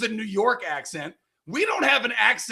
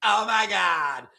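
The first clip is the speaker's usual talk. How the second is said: put-on slow, drawn-out Valley Girl accent; 4 dB louder, slower than usual. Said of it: "there is definitely a Valley Girl".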